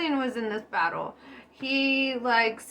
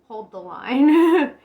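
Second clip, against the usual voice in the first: slow, loud and firm command; commanding order; 8 dB louder, slower than usual. Acted. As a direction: plant your feet and order it. Napoleon ordering troops, acted.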